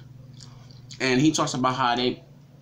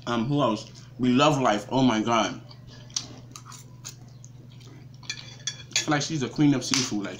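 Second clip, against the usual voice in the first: chewing while talking; talking between bites; mouth full of chicken. Real, not acted.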